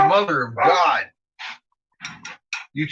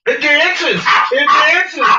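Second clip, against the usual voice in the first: excited squeal; 13 semitones higher, 8 dB louder, much slower than usual. Real, not acted. Excited about food in pan.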